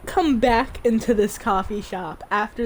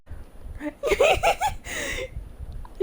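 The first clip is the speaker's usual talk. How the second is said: high squealing giggle; 3 dB quieter than usual. Real, not acted. Squealing at the cute couple.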